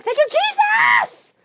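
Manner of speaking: high, loud, drawn-out shout of thanks; belted, overjoyed praise; joyful praise shout. Retold or imitated. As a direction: cry it out to the heavens, elated. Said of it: "I was literally in my car. I'm like".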